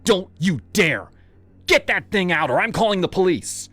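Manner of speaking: high, loud threatening yell; angry mother threatening to call police; furious threat. Acted.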